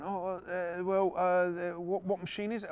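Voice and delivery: mock-clueless, dragging out the fillers; flustered stalling. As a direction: imitate a kid stalling with ums and uhs. Kid stalling when asked about machine.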